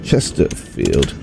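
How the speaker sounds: very low, slow drone; low, halting mumble-to-self while typing; typing aloud. Real, not acted. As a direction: spell out a search word by word, deep down. Types the caller's search aloud.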